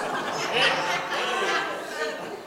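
a light, distant burst of laughter; soft laughter. Real, not acted. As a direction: laugh quietly at the joke. Laughter after idiots joke.

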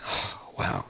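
hushed, breathy amazement; softly retold moment of being blown away; awed amazement.

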